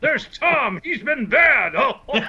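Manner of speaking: high, teasing Santa voice; jolly Santa. Acted. Santa teasing Tom on air, jolly and high. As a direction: play Santa naming who's been bad.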